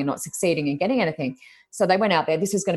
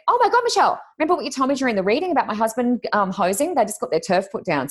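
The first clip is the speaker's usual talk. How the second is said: much higher, bright excited gush; excited client; 7 semitones higher than usual. Retold or imitated. Client greeting the reader at her new home, excited. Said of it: "Linda walked out and went".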